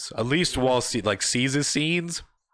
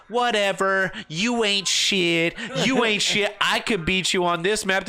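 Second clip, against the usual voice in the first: put-on higher, dismissive girl's taunt; mock girlfriend taunt; 7 semitones higher, slower than usual. Girlfriend taunting the gamer, dismissive mock voice. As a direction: brush him off like the girlfriend.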